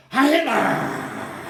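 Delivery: loud, long drawn-out salute shout; imitated roar of answering followers; imitated crowd salute. Crowd round Hitler answers, retold.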